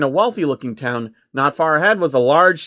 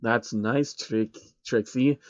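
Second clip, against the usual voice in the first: softer, slow dismissive reply; dismissive mumble; 3 semitones lower, 6 dB quieter, slower than usual. A narrator's reading. Soros brushes Trixie off as he walks by, soft. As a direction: brush her off.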